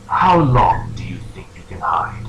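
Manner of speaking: low, slow disembodied voice; mysterious voice. Acted. Game master voicing unseen figure to player, low and ominous. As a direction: draw it out low like an unseen presence. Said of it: "a voice promptly proceeds to speak out".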